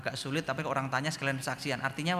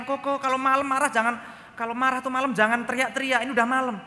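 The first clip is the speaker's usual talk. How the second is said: higher, louder telling-off; mock scolding father; 11 semitones higher, 7 dB louder than usual. Father telling his son off for shouting at night. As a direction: scold the son like an exasperated dad.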